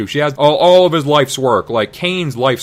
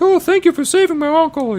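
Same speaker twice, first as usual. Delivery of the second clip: very high falsetto thanks; hammed-up high falsetto gratitude; grateful Leia. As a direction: gush in a squeaky girl voice. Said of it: "Leia's like".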